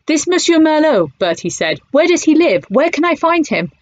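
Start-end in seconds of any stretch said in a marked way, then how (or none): 0.1-1.1 much higher, urgent; eager question. Acted. Bertie eagerly asks the cafe owner, much higher. 1.9-3.7 much higher, urgent; eager question. Acted. Bertie eagerly asks the cafe owner, much higher.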